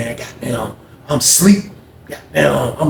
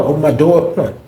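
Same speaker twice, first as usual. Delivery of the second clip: normal talking voice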